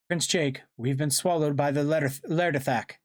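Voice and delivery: high, strained report; urgent report. Acted. Swallowed alien reports to leader.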